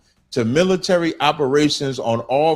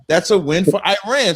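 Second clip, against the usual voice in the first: usual speaking voice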